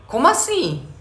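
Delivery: high-pitched imitated bewilderment; baffled child. Child's unspoken reaction to being corrected, acted bafflement. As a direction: act out a child's startled question. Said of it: "o cérebro tá pensante".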